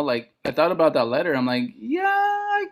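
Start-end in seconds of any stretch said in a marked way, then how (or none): 1.8-2.7 very high, hesitant squeak; imitating a reluctant, high-voiced yes; sheepish admission. Admitting an interest in stand-up to a friend, high and sheepish.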